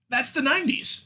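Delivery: high, sing-song brush-off; mock dismissive quote. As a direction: wave it away like a shrugging excuse. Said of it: "can just be written off as".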